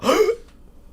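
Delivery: sharp high gasp for air; winded gasp. Shown as a demonstration. Showing the sound someone makes after a gut punch, sharp gasp. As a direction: suck in air like you were punched in the gut. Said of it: "so they go".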